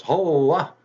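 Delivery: high, loud bragging fox voice; the fooled fox boasting he won't be fooled again; boastful fox. Acted. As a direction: crow it out like a proud hunter fox. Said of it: "and he goes".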